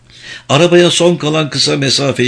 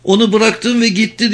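high, agitated outburst, moderately raised; exasperated high-pitched yell, not full force; shouted accusation; 11 semitones higher than usual. A narrator's reading. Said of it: "diye bağırdı Vicky".

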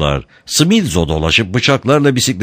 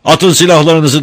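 high, loud command; shouting; 9 semitones higher, 5 dB louder than usual. A narrator's reading. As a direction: shout the order at armed youths. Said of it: "diye bağırdı gene Peppone".

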